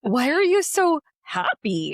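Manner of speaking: high, slow mock puzzlement; imitating baffled colleagues. Colleagues asking her about her happiness, imitated bafflement.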